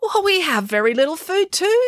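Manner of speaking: high-pitched householder's protest; complaining householder. A narrator's reading. Householder to hungry travellers asking for food, complaining.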